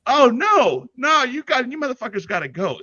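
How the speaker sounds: high, loud fed-up outburst; exasperated yell. Retold or imitated. Friend telling off the gross player couple, a high loud yell. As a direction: blurt it out, fed up.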